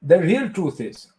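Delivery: Indian-accented mock sage answering an interview question; Sadhguru impression. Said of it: "ask me a question as if I'm Sadhguru".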